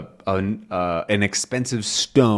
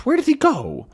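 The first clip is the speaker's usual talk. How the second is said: put-on high, puzzled old man voice; confused old professor. Calculus puzzling over what he misheard, high confused voice. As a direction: wonder aloud, bewildered and high.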